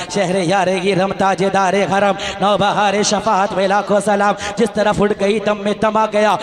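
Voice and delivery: low, measured chanting of the salaam; devotional recitation. Acted. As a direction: recite the verses with reverence.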